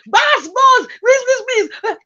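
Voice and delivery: frantic begging. Retold or imitated. The other girl begging the attacker, frantic high pleading. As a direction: beg desperately for mercy.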